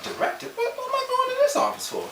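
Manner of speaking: high, startled confusion; alarmed disbelief. Retold or imitated. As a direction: sound caught off guard by the summons. Summoned to HR unexpectedly.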